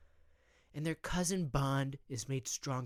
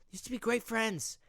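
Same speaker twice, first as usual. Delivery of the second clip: usual speaking voice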